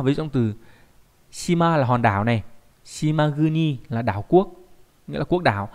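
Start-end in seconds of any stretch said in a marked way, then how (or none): none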